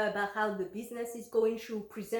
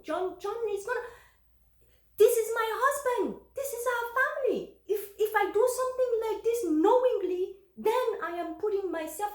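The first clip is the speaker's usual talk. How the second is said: agitated outburst; 9 semitones higher, 3 dB louder than usual. Real, not acted. Agitated about husband and family.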